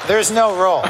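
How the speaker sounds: laughing through the words. Real, not acted. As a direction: say it while laughing. GM laughing at the player.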